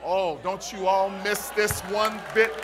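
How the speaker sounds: usual speaking voice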